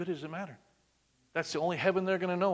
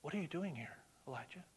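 much softer, hushed question; soft quiet God; 13 dB quieter than usual. Retold or imitated. God gently asking Elijah in the cave, hushed. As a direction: ask it gently, barely above a breath.

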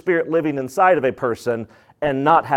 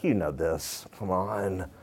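low, drawn-out mock pleading; lightly voiced weary friend; exasperated; 8 semitones lower, slower than usual. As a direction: coax someone who's playing dumb. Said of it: "and you're like".

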